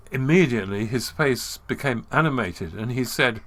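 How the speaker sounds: ordinary talk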